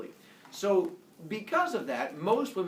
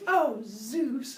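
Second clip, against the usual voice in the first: swooning; 8 semitones higher than usual. Acted. Leto swooning at Zeus.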